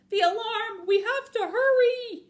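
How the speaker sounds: high, very loud cartoon alarm; hammed-up high panic; panicked shout. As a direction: cry out urgently to get everyone moving.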